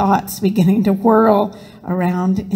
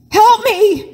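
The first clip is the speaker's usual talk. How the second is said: high, loud cry for help; 13 semitones higher, 5 dB louder than usual. A narrator's reading. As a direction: cry out in urgent distress, pitch leaping up. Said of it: "cried Mary".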